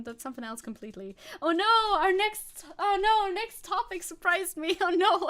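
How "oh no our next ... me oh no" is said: high, loud alarmed yelp. Real, not acted. Streamer spots a dreaded topic, high alarmed oh no.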